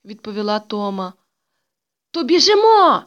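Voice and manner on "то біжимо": loud, high cry to get running; acted kid's rallying yell; excited shout. A narrator's reading. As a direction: shout it out as an eager call to go. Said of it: "закричав Петрик".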